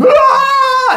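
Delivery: very high, loud scream; hammed-up shriek of horror; villagers' scream. Villagers look at the bloodied man, high loud scream. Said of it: "the villagers start screaming like".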